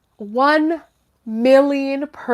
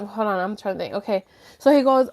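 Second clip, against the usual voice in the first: ordinary talk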